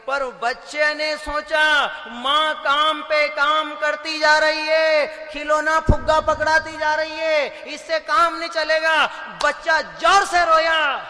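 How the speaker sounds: loud, high, slow preaching; fiery, slow sermon climax; preaching at full force. Acted. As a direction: drive it home loud to the crowd.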